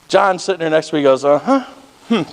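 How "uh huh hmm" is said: short stifled hums breaking into a small laugh; imitated chuckling. Passenger John reacting to the swearing, imitated stifled chuckle. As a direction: mimic someone trying not to laugh. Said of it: "Kinda laughing like that".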